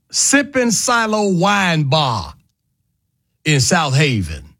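slow, drawn-out reveal of the name; big reveal. Real, not acted. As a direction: savor each word of the name like a reveal. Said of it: "I can say the name of the restaurant".

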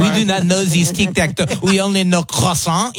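high-pitched, hammed-up French accent. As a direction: imitate indignant French guys with a thick accent. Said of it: "a perfect accent".

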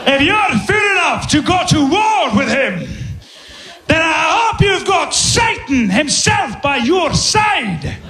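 high, slow Scottish proclamation; Scottish nobleman. Acted. Nobleman on horseback warning an opponent, grand Scottish accent. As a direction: deliver a rousing, drawn-out warning in a thick Scottish accent. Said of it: "I get a Scottish nobleman".

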